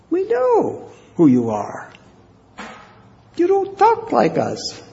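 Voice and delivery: very high, taunting voice; mocking accuser. Crowd mocking Peter's dialect, high taunt. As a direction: taunt him for his accent.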